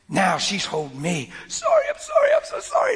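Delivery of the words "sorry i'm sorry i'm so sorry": imitated sobbing apology. Wife running to her husband in the garden, sobbing sorry in a much higher wail. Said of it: "She's bawling".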